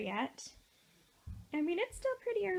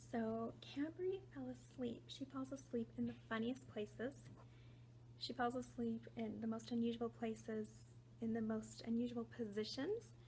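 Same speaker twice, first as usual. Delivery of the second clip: hushed voice; 3 semitones lower, 10 dB quieter than usual. Real, not acted. Daughter asleep nearby, talking hushed.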